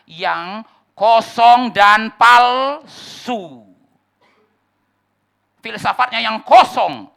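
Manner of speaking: loud, high, emphatic preaching; full-force preaching. Acted. Preacher denounces false teaching to the congregation, thundering. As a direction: drive the warning home from the pulpit.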